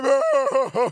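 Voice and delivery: hammed-up loud sob; loud cry. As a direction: let out a big ugly cry. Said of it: "I was loud cry".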